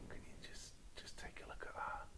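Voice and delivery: very soft, voiceless hush; hushed wonder, all breath; awed whisper. Real, not acted. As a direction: whisper it as if marvelling. Awed by the foil card.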